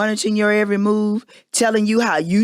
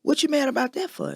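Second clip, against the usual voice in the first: dismissive partner mimicked; 6 semitones higher, 4 dB quieter than usual. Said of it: "tell me how to feel".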